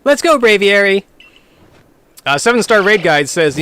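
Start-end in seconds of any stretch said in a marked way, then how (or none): 0.0-1.0 high, loud cocky rival call; character voice. Acted. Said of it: "how you do the voice act".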